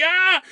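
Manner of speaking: high, short scream of panic, imitated; hammed-up freak-out yelp; mock scream. Retold friends freaking out.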